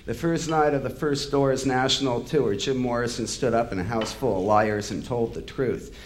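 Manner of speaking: usual speaking voice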